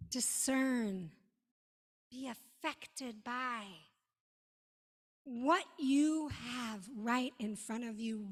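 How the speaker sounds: ordinary talk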